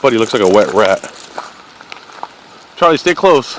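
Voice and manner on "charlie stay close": calling out. Real, not acted. Calling the dog back.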